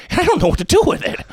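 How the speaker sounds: very high, hurried helpless villain; Joaquin Phoenix's Joker. Acted. Sensitive Joker admits he is lost, high and fast. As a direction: rush it out in a high, fragile voice. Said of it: "Joaquin Phoenix in the Jo".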